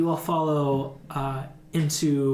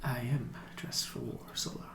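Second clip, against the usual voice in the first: grave announcement; 11 dB quieter than usual. Acted. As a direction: deliver grim news under your breath. Blackstaff gravely announces they are at war.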